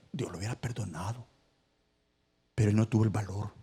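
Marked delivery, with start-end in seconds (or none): 0.1-1.2 hushed whisper; dropped to a whisper for effect. Acted. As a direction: breathe the word softly. Preacher's hushed point.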